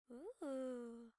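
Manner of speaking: soft, savoring ooh; delighted ooh. Acted. Goldilocks smelling goulash, soft delighted ooh. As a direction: breathe it in like a tasty smell.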